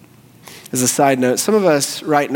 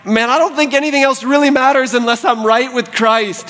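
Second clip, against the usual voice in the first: raised pitch, earnest declaration; growing conviction; 9 semitones higher than usual. Acted. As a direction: voice a believer's urgent conviction. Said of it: "this thing is growing in you where you're like".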